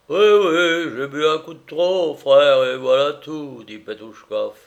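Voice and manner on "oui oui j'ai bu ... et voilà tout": loud, higher tipsy voice; sloppy, emphatic drunk admission; drunken master, owning up. A narrator's reading. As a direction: confess it with boozy bluster.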